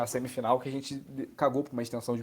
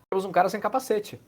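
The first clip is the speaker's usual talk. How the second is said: surprised spotting; 7 semitones higher than usual. Real, not acted. Noticing player without helmet.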